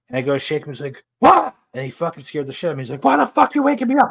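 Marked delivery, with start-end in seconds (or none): 1.2-1.5 high, loud startled yelp; imitated startled shout; startled scream. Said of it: "He's screaming at him". 3.0-4.1 high, fast outraged yell; high-pitched retold outburst; imitated screaming. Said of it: "He's screaming at him".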